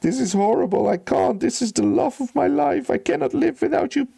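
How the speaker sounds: high-pitched hammed-up wailing sobs; grief-stricken wail, begging for help; crying and sobbing. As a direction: plead through tears in a high, desperate voice. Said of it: "he starts crying and sobbing and saying".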